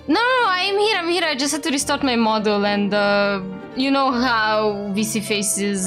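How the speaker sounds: normal talking voice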